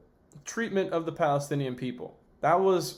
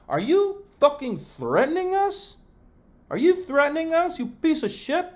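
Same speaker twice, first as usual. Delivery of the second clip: outraged; 11 semitones higher, 4 dB louder than usual. Real, not acted. Outraged reply to threat.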